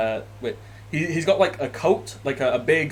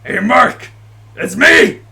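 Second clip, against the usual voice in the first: put-on high, very loud hail to an old buddy; big hearty shark-man shout; boisterous greeting; 10 semitones higher, 12 dB louder than usual. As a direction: bellow a jubilant hello from afar. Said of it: "and he goes".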